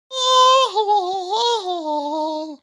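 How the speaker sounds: loud, high, long hooting laugh; hammed-up high wavering whoop; wordless hooting outburst. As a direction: let out a sustained high hoot. [name] answers with wordless outburst.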